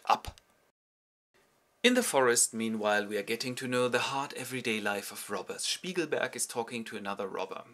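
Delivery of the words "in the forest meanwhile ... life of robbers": normal talking voice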